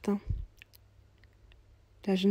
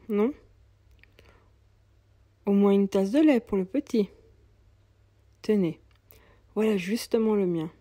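put-on a touch louder, gentle old man offering food; kindly old cobbler; 3 dB louder than usual. Old cobbler offering bread and milk to the mother, lightly voiced and kind. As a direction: coax her kindly to warm up.

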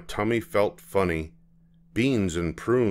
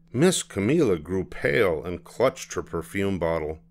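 plain reading voice

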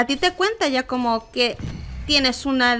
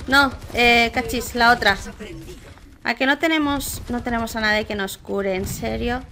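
ordinary talk

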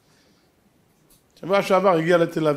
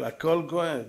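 הכל כואב said softer, sinking low; 4 semitones lower, 8 dB quieter, slower than usual. Shown as a demonstration. Preacher sighing that everything hurts, softer. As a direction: let הכל כואב fall away quietly.

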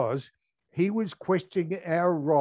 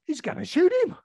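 alarmed thought; 13 semitones higher, faster than usual. Retold or imitated. Colonel draws pistol, remembered thought.

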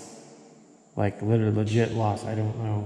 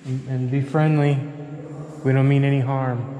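raised, gentle spirit-box call; 3 semitones higher than usual. Real, not acted. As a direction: coax the ghosts to talk. Calling out to spirits.

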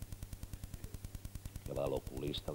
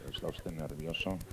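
oculista suave; 4 semitones lower, 7 dB quieter than usual. Acted. Oculista habla al paciente.

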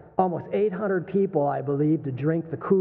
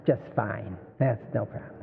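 lightly mocking prim church lady; church lady impression; 5 semitones lower, 5 dB quieter than usual. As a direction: mimic a prissy church lady brushing it off. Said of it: "like the church lady".

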